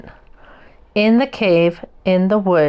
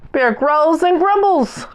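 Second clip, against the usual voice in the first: high, growling storyteller voice; the woken bear growling at his guests; growly bear; 8 semitones higher than usual. A narrator's reading. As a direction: growl the words like a grumpy bear. Narrating angry bear.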